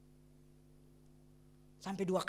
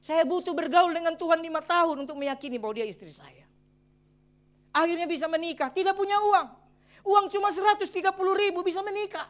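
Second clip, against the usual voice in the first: rising, louder preaching testimony; preaching at full force; 6 semitones higher, 7 dB louder than usual. Real, not acted. Pastor testifying to the congregation, preaching loudly. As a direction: preach it out to the church, building.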